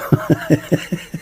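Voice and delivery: quiet laugh. Real, not acted. Laughs at his own joke.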